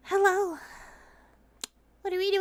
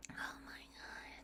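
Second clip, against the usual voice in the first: barely audible, voiceless murmur; mortified whisper; 27 dB quieter than usual. Pretend. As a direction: whisper it in mock despair after an outburst. Spent after yelling outburst.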